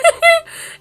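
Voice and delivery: high, loud laugh mid-sentence; real laughing outburst; burst of laughter. Real, not acted. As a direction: break off into a cackle. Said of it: "I'm laughing".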